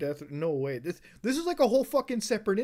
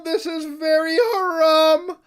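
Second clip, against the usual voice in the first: loud, slow, higher mock-scandalised cry; drawn-out hammed-up horror; mock outrage; 5 semitones higher, 6 dB louder than usual. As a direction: play the scandalised elder.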